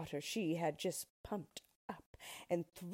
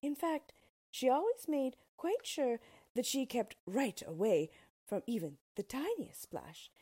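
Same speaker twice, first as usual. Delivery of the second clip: ordinary delivery, nothing marked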